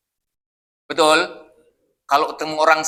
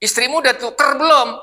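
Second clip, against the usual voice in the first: blunt East Javanese tease; 8 semitones higher than usual. Retold or imitated. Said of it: "ngomongnya kelihatannya kasar".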